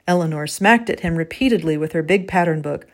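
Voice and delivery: usual speaking voice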